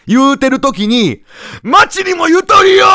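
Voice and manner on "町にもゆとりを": higher, louder and slow, out of nowhere; a slow, soaring mock rallying cry; sudden shout. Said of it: "って叫んだらしいです".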